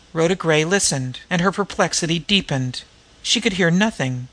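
ordinary delivery, nothing marked

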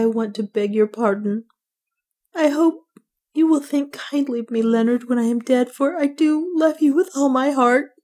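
high-pitched, pleading woman's voice; tearful confession. A narrator's reading. Woman begging pardon of her love before death, tearful. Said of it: "tears began to roll down".

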